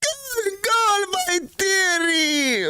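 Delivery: put-on a poor Scottish accent, roaring the goal; hammed-up Scottish commentator calling the goal; bad Scottish commentator. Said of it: "My Scottish accent is really bad".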